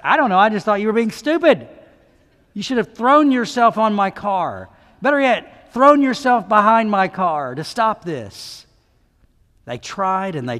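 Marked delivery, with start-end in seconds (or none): 0.0-8.7 higher, loud exasperated complaint; retold frustrated rant. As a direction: vent at the kids in mock frustration. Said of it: "I said".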